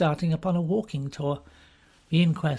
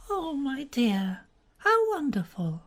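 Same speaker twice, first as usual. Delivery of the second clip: put-on higher, languid young society woman; Frankie's voice; 9 semitones higher, slower than usual. To Bobby, a weary young woman sighing about everything. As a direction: drawl it out wearily, bored with everything.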